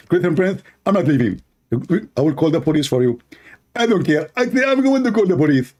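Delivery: high, petulant caricature; lampooned rival refusing to leave; mock debater. Mock debater threatens police.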